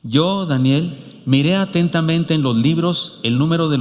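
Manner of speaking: Yo, Daniel drawn out very slowly for the congregation; slow unison reading. Shown as a demonstration. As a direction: read Yo, Daniel slowly together. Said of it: "lo leen conmigo, por favor".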